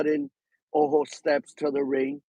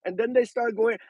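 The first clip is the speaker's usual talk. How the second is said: ordinary delivery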